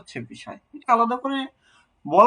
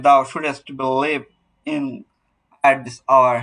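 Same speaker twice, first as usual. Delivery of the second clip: "Thou shouldst believe in at this hour" very slowly, beat by beat; broken into syllables; 4 semitones lower, 4 dB louder, much slower than usual. Shown as a demonstration. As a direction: scan "Thou shouldst believe in at this hour" with pauses between beats. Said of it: "ভেঙে ভেঙে". ("Thou shouldst believe in at this hour" ভেঙে ভেঙে, ধীরে)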